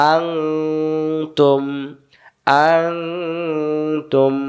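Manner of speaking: আং held in a long hum before তুম; hidden nun. Shown as a demonstration. Teacher joining syllables for students, long nasal আং then তুম. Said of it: "গোপন করে পড়তে হবে".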